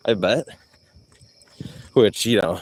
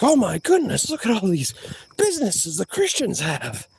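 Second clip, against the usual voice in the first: mock shocked; 8 semitones higher than usual. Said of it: "I'd be like".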